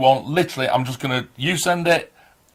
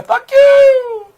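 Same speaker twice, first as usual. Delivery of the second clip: much higher, loud delighted shout; excited thanks; 11 dB louder than usual. Real, not acted. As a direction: burst out with thrilled gratitude. Thanks viewer for her collection.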